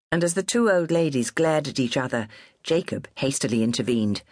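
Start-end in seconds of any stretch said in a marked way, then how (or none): none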